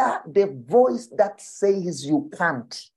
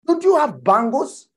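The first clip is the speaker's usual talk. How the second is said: higher, louder incredulous questions; mock disbelief; 7 semitones higher, 4 dB louder than usual. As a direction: needle the listener in disbelief. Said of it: "He says".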